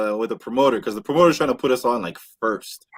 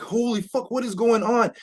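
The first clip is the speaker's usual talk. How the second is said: high amazed disbelief; 9 semitones higher, 5 dB quieter than usual. Retold or imitated. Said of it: "we're like".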